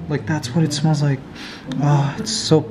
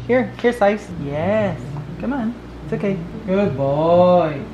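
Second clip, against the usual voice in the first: coaxing the dog. Real, not acted. Coaxing the dog to take medicine.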